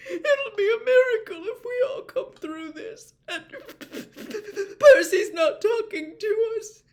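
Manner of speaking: high, loud, stammering through sobs; sobbing outpour. A narrator's reading. Missus Weasley lists her dread for the family, sobbing. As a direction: pour out every fear through tears.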